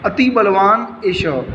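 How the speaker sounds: "अति बलवान एषः" said slowly, word by word; slow careful reading. Shown as a demonstration. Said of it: "इसको पढ़ने का ध्यान से देखो". (धीमा, स्पष्ट उच्चारण)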